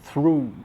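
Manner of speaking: "through" said as a single syllable; one syllable. Shown as a demonstration. Teacher gives an example of a long-spelt monosyllable, "through" as one syllable. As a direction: say "through" in one unbroken stroke. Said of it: "uma palavra que tem um tanto de letra e é monossílaba".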